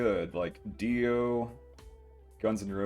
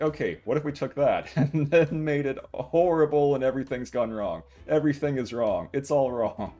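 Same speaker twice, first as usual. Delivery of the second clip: gleeful mock pitch; 5 semitones higher, 6 dB louder than usual. Mocking the show's creators.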